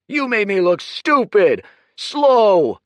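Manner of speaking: high, very loud furious yell. Acted. Kuruk yelling at Dorian over the humiliation, furious. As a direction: scream it in his face. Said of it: "yelled Kuruk".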